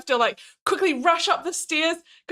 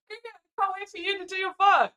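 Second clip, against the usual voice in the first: mock eager partner; 6 semitones higher than usual. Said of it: "He's like".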